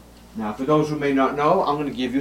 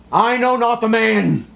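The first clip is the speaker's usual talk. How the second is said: much higher, louder cry of denial; desperate denial; 11 semitones higher, 6 dB louder than usual. Acted. Peter denies Jesus a third time, shouting. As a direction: shout it out like a cornered man.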